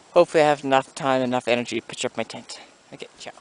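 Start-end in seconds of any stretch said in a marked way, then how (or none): none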